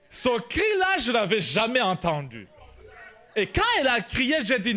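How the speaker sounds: ordinary delivery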